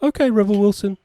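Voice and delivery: high mocking producer voice; squeaky, fawning yes-man; mocking the producers in a high put-on voice. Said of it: "And the producers went".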